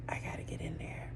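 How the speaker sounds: urgent breathy whisper to herself. Retold or imitated. Late driver whispering to herself before the appointment. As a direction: hiss it under your breath, hurrying. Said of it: "I'm like".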